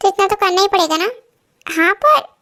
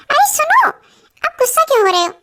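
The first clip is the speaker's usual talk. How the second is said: calling out; 10 semitones higher than usual. Acted. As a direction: call him back before he storms off. Wife calls after angry husband. (ऊँची आवाज़ में पुकारना)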